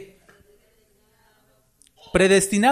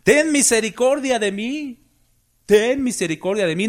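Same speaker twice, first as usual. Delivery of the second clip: imitated cry for mercy; 7 semitones higher than usual. Blind Bartimeo calling to Jesus for mercy, imitated high cry. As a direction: call out high, stretching the plea. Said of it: "le gritaba".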